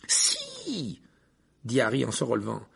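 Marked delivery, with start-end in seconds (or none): 0.1-1.0 protestation vive. Acted. Harry refuse d'arrêter.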